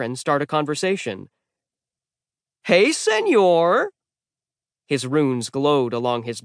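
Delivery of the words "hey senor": much higher, slow, cheery sword voice; chatty sword Jack. A narrator's reading. The sword Jack greeting falling Magnus, cheery. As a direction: greet him like an old buddy.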